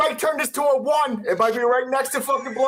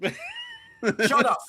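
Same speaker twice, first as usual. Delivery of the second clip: squeaky shut up; 4 dB quieter than usual. Real, not acted. Teasing friend back.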